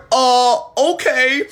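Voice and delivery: high, drawn-out hesitant okay; mock timid okay. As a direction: stretch it out high, unsure after a warning. Acting his reply to the warning.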